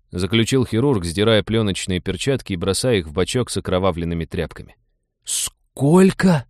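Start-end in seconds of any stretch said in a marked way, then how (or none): 5.2-6.5 high, aghast question; astonished outburst, much higher; shocked gasp. A narrator's reading. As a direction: gasp it out, pitch leaping up at the price. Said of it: "ахнул Станислав".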